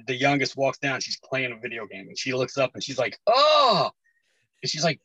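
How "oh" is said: loud, high-pitched "oh!" imitating a startled kid; startled child. Dad imitates daughter seeing the gory movie, startled. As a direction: mimic your child's sudden shock, high and loud.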